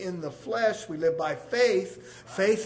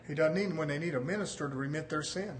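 ordinary talk

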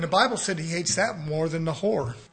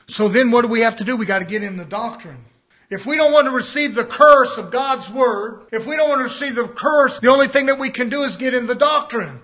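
loud, raised preacher's build; preaching at full force; 4 semitones higher, 7 dB louder than usual. Acted. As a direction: drive the doctrine point home, loud.

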